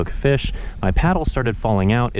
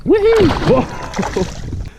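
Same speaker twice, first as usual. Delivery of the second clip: high loud whoop of joy; 3 dB louder, much slower than usual. Real, not acted. Angler whoops after landing a fish, elated. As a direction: let out a victory whoop.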